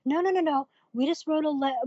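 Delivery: mild retold nervous denial; nervous backpedalling. Elders deny her accusation, imitated nervous backpedalling. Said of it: "they were very nervous".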